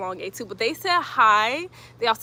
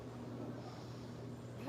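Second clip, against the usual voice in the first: soft laugh; 23 dB quieter than usual. Real, not acted. Laughs mid-stretch.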